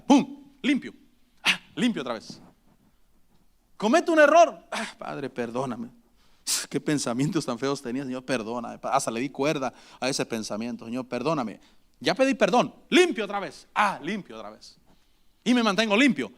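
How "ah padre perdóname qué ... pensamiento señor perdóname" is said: low, hushed, sighing plea; imitating a believer murmuring sorry after yelling at his wife; mock contrite prayer. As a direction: breathe it like a quick sorry prayer. Believer quickly asks forgiveness.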